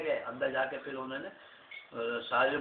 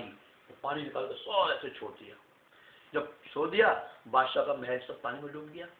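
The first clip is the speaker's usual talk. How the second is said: plain reading voice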